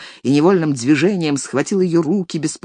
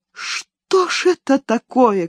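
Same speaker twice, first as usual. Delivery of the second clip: slow, higher indignant cry; 7 semitones higher, slower than usual. A narrator's reading. Elis crying out at the nanny, indignant and higher. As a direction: cry out in outrage. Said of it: "крикнула Элис".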